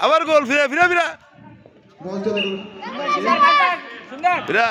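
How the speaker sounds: high, excited goal calls; excited cheering. Real, not acted. Fan cheering another goal.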